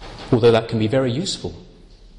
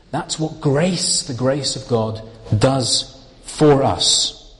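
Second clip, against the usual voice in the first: normal talking voice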